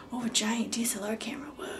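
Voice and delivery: quick whispered mock awe; hushed onlooker. Onlooker spotting a huge camera, hushed mock awe. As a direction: imitate a gawker under your breath.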